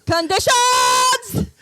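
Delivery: high, drawn-out mock shout; mock outrage. Friend condemns the other's crude joke, in mock outrage. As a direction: bellow it slowly in hammed-up outrage.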